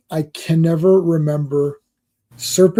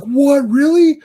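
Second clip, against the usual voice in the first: surprised caller; 10 semitones higher, slower than usual. Retold or imitated. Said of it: "He's like".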